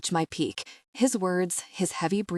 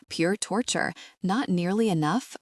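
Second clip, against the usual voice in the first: ordinary delivery, nothing marked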